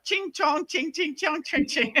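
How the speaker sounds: high mocking sing-song voice. Father telling a joke, in a mocking sing-song. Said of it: "He goes".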